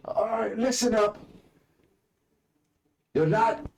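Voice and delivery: high, loud commanding bellow; orc general shouting. Acted. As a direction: bark the order to the troops. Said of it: "says the orc general".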